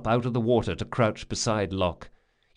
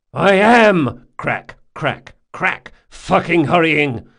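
loud, gritted effort between blows; strained angry grunt; 7 semitones higher, 9 dB louder, slower than usual. Acted. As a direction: snarl it while chopping. Jean hacking at the cask.

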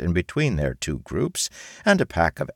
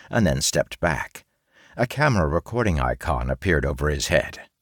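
ordinary delivery, nothing marked

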